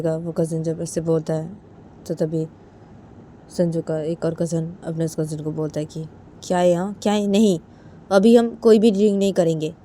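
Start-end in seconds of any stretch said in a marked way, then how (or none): 6.4-9.7 louder, raised-pitch objection; acted sharp protest; firm refusal. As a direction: shut the suggestion down loudly. Cousin objects to drinking.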